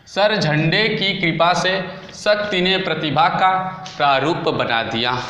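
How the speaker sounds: slow, deliberate reading of the mnemonic, word by word; slow recitation. Shown as a demonstration. Teacher presenting a memory trick to students, slow and deliberate. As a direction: spell out the trick slowly so it sticks. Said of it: "देखिएगा trick दिया है".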